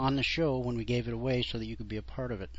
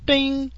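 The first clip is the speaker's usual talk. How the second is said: imitated scale ding; 14 semitones higher, 6 dB louder than usual.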